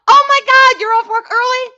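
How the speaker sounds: loud, very high excited squeal; shrieking with delight; thrilled surprise. Real, not acted. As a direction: greet a friend you didn't expect, overjoyed. Thrilled friend is off early.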